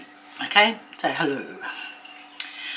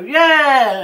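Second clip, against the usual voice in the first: higher, louder mock cheer; imitated crowd cheer; 7 semitones higher, 6 dB louder than usual. Audience answering the entertainer's call, mock cheering. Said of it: "he starts shouting out".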